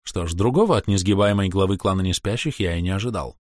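ordinary delivery, nothing marked

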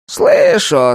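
high, creaky wooden golem; a stiff creaky tree creature. A narrator's reading. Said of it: "раздаётся голос голема Кирка".

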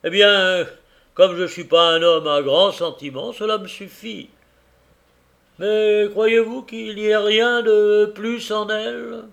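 high, loud, needling voice; sly Tackleton. A narrator's reading. Tackleton needling the carrier about his wife, high and loud. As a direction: prod the husband with mock respect.

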